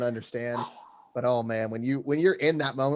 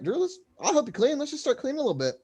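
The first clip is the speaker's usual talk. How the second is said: high, sing-song fake helpfulness; mock helpful offer; 12 semitones higher, faster than usual. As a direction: sound eager to help, knowing you'll bail. Offering to help clean, then leaving.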